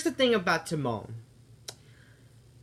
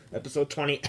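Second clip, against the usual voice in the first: pointed hint slipped between fake coughs; mock throat-clearing, words tucked in between; fake coughing; 2 semitones lower, faster than usual.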